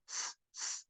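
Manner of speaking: soft voiceless "s" hiss; "s" as a short stream of air; isolated S sound. Shown as a demonstration. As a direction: let out a bare "s" with no vowel. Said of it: "fizer só o som da letra".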